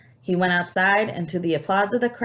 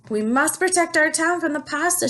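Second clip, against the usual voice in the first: shouting; 9 semitones higher, 3 dB louder than usual. A narrator's reading. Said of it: "shouted the mayor".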